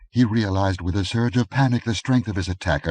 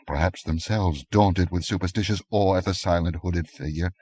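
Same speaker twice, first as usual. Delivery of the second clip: ordinary delivery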